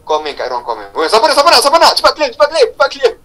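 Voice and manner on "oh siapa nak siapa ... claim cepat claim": high, rapid-fire hawker's call; mock auctioneer urgency. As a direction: playfully rush the room into a bidding frenzy. Mock auction, urging quick claims.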